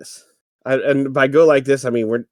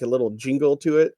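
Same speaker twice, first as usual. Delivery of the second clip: normal talking voice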